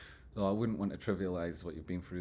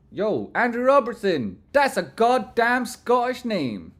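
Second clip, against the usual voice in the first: higher, much louder hype shout; excited shout; 14 semitones higher, 12 dB louder, slower than usual. Real, not acted. Streamer shouting a viewer's name to hype him up, loud.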